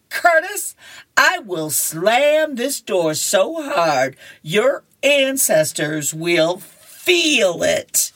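slow, high-pitched mock threat; comic-strip girl yelling at a boy; acted yelling. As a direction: drag out each word in hammed-up outrage. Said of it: "she yells at him".

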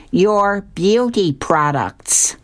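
slow, high-pitched complaint without real volume; drawn-out mock screech of blame; mock scream. A narrator's reading. Fish blaming the beauty products seller, mock scream. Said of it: "the fish is screaming at her".

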